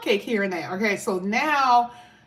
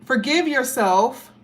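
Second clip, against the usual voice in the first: slow, higher, with weight on forgive; urgent plea; 4 semitones higher, 4 dB louder, slower than usual. Real, not acted. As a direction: press the word forgive on them gently but firmly. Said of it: "urging the viewer".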